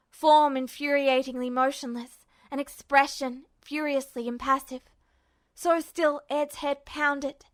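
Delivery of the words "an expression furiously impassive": ordinary delivery, nothing marked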